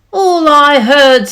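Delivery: loud, slow scornful snap; snapped. Acted. Kangaroo dismissing Horton, a loud slow snap. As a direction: bite off the words with contempt. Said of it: "snapped the big kangaroo".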